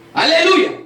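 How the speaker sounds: loud, high cry of praise; a burst of hallelujah from the pulpit; joyful shout. Real, not acted. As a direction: shout it out to the congregation. Praise shout during church testimony.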